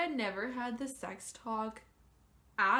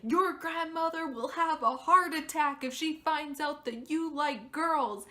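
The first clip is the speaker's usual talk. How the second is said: imitating mom, much higher and louder; hammed-up alarmed mother; mock panicked mom; 6 semitones higher, 7 dB louder than usual. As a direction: daughter mimicking mom's warning about grandmother. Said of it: "she just unloads on me".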